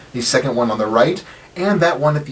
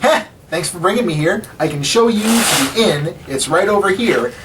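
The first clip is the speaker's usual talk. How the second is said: higher-pitched, chipper character voice; lively halfling; 7 semitones higher than usual. Acted. Rendil thanking the party at the inn, higher lively voice. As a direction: play a lively little guide, pitched up.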